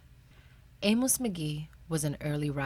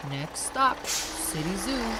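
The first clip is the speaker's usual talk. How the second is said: bus driver's call; 4 semitones higher, 4 dB louder, slower than usual. Acted. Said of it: "the bus driver would call".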